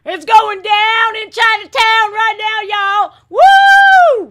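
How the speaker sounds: loud, high hyped yelling; mock crowd going wild. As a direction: imitate the whole crowd cheering. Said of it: "all of us were like".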